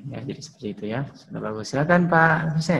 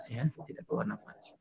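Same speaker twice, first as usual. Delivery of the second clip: whispered; 5 semitones lower, 18 dB quieter, much faster than usual. Shown as a demonstration. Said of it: "sifatnya jahar ya, tidak keluar nafas".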